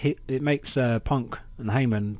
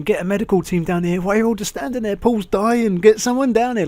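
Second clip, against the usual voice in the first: high, loud hammed-up alarm; shrill-free hammed-up shout for a medic, louder and higher; mock panic; 9 semitones higher, 8 dB louder than usual. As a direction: yell for help in fake panic, rising.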